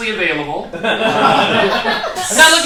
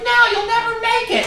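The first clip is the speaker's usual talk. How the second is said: mock kid doubting. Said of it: "the kid's like".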